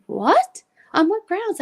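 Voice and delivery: indignant disbelief. Retold or imitated. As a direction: re-enact demanding a reason, incredulous. Indignant reply to being sent away.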